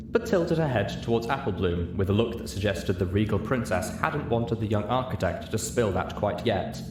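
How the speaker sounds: usual speaking voice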